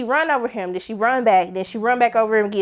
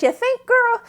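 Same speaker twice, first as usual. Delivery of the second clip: high sarcastic retort; 12 semitones higher, slower than usual. Real, not acted. Answering Myrtle's gloom, high sarcastic. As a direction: throw it back with sass. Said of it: "And I said".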